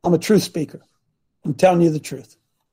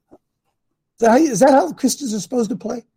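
usual speaking voice

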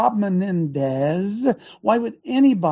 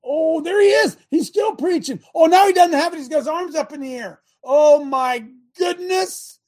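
mock horror; 7 semitones higher, 5 dB louder than usual. Real, not acted. Host mocking the bullhorn evidence, hammed-up shocked cry. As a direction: wail it like a scandalous crime.

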